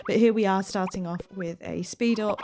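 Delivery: ordinary talk, nothing marked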